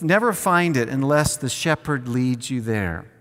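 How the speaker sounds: normal talking voice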